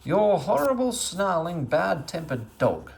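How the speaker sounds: high, loud, cross outburst slowing at the end; bad-tempered. A narrator's reading. Meddle blaming Dame Grumble's dog in a bad temper. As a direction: spit out the insults in a temper. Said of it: "in a very bad temper himself".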